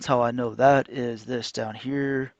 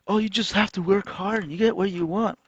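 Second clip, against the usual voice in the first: higher, sing-song mimicry; mocking glib advice; 7 semitones higher than usual. People preaching hard work to him, mockingly higher. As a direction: parrot it in a mock-cheery higher pitch.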